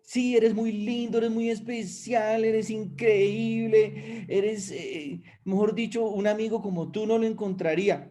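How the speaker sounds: voz aguda de amiga que elogia; imitando halagos. Retold or imitated. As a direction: cumplidos exagerados en tono alto. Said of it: "mi amigo o mi amiga me dice". (la amiga lo halaga, voz aguda y dulzona)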